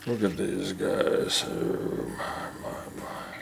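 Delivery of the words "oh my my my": soft whispered coo; hushed whisper. Real, not acted. Owner marvelling at the baby bird, whispering. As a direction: whisper so the chick isn't startled.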